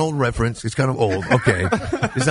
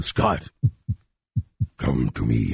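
very low calling; 아주 낮은 목소리로 부르기; summoning call; 11 semitones lower, slower than usual. Retold or imitated. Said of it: "call over to him".

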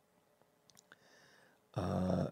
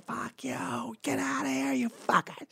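high whispery mock yell of a drunk woman; lightly mocking, high-pitched drunk woman hissing insults; mock shrill bar woman. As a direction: squeak it out like a tiny angry woman. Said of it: "these women at a bar going".

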